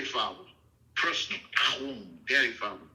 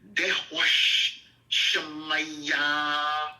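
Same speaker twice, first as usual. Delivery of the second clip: slow, drawn-out liturgical recitation; Aramaic prayer recital; much slower than usual. Shown as a demonstration. Teacher reciting the prayer in Aramaic to his host, slow and solemn. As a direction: intone each Aramaic word slowly like a prayer. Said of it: "first in the Aramaic".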